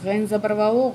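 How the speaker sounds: normal talking voice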